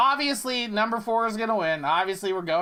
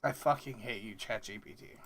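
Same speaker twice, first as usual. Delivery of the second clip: under-breath curse at the AI; quiet grumble; 12 semitones lower, 14 dB quieter than usual. Real, not acted. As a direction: mutter it, beaten. Defeated grumble at the AI.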